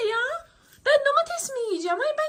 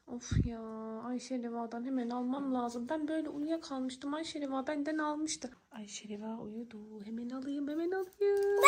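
low, barely voiced murmur; furtive hush; 9 semitones lower, 12 dB quieter than usual. Girl plans to take candy from sleeping Ayşe Reva, furtive hush. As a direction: plot to yourself without waking her.

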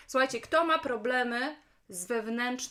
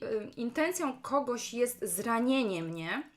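normal talking voice

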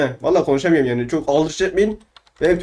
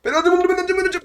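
very high, squeaky rapid chant; excited squeal; 12 semitones higher than usual. Pretend. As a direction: squeal your claim over the loot. Excitedly grabbing the diamonds.

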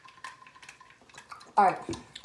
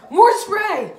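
high, loud call for more spray; loud urging; 11 semitones higher, 12 dB louder than usual. Real, not acted. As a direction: shout it to push him on.

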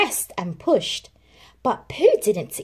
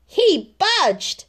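excited; 11 semitones higher, 5 dB louder than usual. Acted. Rabbit's excited discovery.

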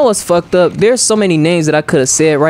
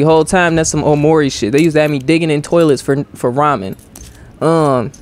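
normal talking voice

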